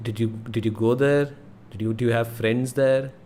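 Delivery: ordinary delivery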